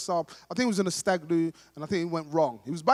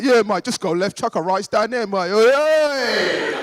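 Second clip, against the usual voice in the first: loud mimicked Cockney geezer; boisterous Cockney, ending in a held yell; Cockney accent; 3 semitones higher, 8 dB louder, slower than usual. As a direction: shout directions like a Cockney. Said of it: "I behaved exactly like him".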